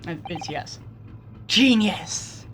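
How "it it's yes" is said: usual speaking voice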